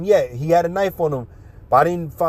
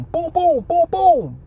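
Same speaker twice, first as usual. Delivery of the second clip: high punchy boom noises; imitated punches landing in a retold fight; punch sound effects; 13 semitones higher, slower than usual. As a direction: mimic each blow as a sharp boom.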